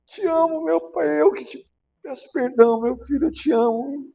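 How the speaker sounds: reliving a sobbing apology while hugging him; a touch of tears in a high pleading voice; retold crying plea. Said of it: "Eu já abracei chorando".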